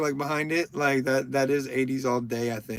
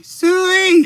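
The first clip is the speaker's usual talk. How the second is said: high, loud pig call; 9 dB louder than usual. Pretend. Calling like a pig at a viewer's request, high loud squeal. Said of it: "say Sui, please?".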